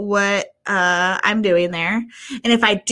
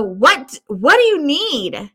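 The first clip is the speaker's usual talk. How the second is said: irritated; 4 semitones higher, slower than usual. Retold or imitated. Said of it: "And I go".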